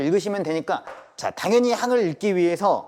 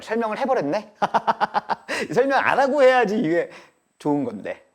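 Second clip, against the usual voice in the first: laughing at own slip, higher pitched; 6 semitones higher, 4 dB louder than usual. Real, not acted. Teacher realizes he already explained it, laughing. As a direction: laugh through the regret, voice lifting.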